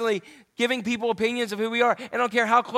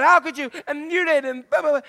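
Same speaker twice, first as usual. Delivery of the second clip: mock angry fist-shaking; 6 semitones higher, 4 dB louder than usual. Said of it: "shaking our fist at God".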